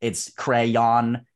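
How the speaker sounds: crayon stretched slowly into "cray-yon"; drawn-out two-syllable crayon. Shown as a demonstration. Showing the way that takes too long, drawn-out crayon "cray-yon". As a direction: spell out both syllables of crayon, slow. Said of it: "that just takes way too goddamn long".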